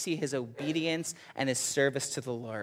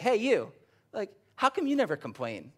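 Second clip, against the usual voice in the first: a light mock coworker, higher, calling over; 7 semitones higher than usual. Preacher voicing a coworker calling over to him, puzzled. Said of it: "they're like".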